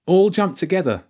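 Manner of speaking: a loud, high call to jump now; shouted. Acted. James shouting to the group to jump, loud and high. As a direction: yell the order to leap together. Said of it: "James shouted".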